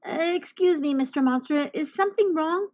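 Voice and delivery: put-on boy politely asks the monster. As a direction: ask carefully, like a polite child.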